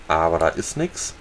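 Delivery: ordinary talk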